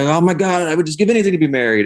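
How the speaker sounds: high, fast gushing plea; desperate longing. Retold or imitated. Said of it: "some people are like".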